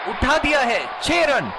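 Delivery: excited shout calling a six; hindi cricket commentary. Acted. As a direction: raise it for the big hit.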